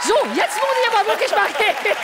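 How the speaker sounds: high and loud, announcing exit; loud exclamation. Real, not acted. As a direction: call it out over the crowd. Dramatic exit through audience.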